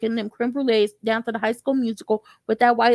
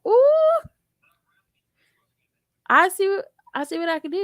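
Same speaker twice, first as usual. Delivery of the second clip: intrigued ooh; 7 semitones higher than usual. Retold or imitated. Robin intrigued flirt.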